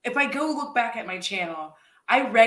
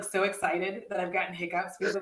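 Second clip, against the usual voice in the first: ordinary talk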